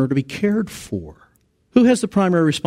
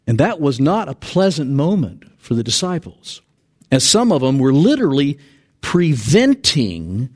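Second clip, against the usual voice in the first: normal talking voice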